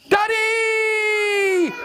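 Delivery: put-on high, long-held baby yell; shouted out. New baby calling to Stan, high drawn-out shout. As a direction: squeal it out, stretching the last vowel. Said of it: "it shouted out".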